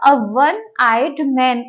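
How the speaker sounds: very slowly, each word spaced out; slow example phrase. Shown as a demonstration. Teacher to students, dictating the example very slowly. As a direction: spell out the example slowly for the class. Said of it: "तो यहाँ पर भी ये क्या होगा?".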